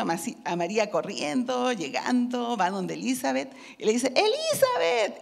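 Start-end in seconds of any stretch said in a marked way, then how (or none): 4.1-5.2 high, slow call across the house; joyful call of arrival. Acted. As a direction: call her name from the doorway. Mary calling out on arrival.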